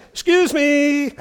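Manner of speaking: high, slow, mock-polite schoolboy "Excuse me!"; cheeky interruption. Boy correcting teacher in class.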